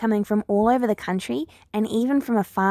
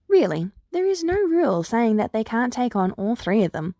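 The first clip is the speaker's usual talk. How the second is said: ordinary delivery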